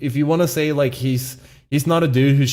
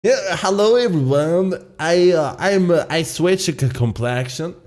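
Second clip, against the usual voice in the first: mock Swedish cartoon walrus accent; hammed-up Swedish accent, imitating the walrus; 4 semitones higher than usual. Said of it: "it's the Swedish accent".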